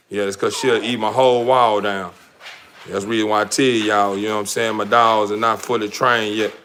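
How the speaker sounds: usual speaking voice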